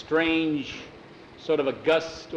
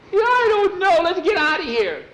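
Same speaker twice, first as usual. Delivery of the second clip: very high, loud and shaky; high panicked plea; 9 dB louder than usual. Acted. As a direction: blurt it out in fright. Frightened boy after the sighting.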